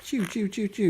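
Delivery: high-pitched bird chirp mimicry; quick repeated high chirps; imitating chirps. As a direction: chirp playfully back at a little bird. Imitating the pet bird's chirps.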